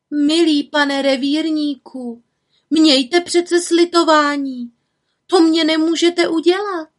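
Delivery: high, slow, loud mock pleading; pleading old woman. Witch pretending to plead with the forester. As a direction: beg him for mercy, hammed up.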